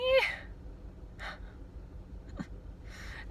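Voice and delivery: a long, breathy real yawn; drawn-out tired yawn; big yawn. Real, not acted. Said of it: "I'm yawning".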